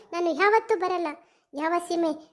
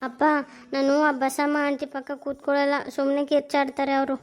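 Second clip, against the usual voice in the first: lightly voiced little girl complaining; a hint of a sulky child; child's voice; 6 semitones lower than usual. (ಅಪ್ಪನಿಗೆ ದೂರುವ ಪುಟ್ಟ ಹುಡುಗಿಯ ಸ್ವರ)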